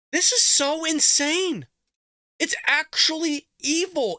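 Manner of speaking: slow, high and heated; outraged disbelief. Real, not acted. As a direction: drag out each word in disgust. Ranting at press secretary clip.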